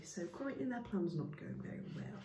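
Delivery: ordinary delivery, nothing marked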